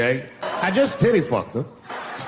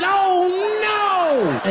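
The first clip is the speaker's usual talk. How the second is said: shocked cry. Retold or imitated. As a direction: yelp it out in sudden shock. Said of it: "I said".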